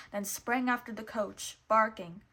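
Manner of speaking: ordinary delivery, nothing marked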